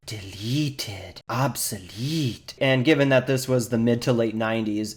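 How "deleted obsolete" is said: very slow, drawn-out henchman voice; Injun. Acted. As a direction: stretch each word out like a creepy lab assistant. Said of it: "he says".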